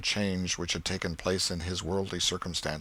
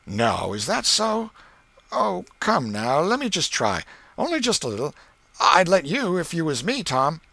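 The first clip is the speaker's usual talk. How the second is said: put-on lightly raised, eager boy voice; boy Ben coaxing; 8 semitones higher, 5 dB louder than usual. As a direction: coax him like a curious kid.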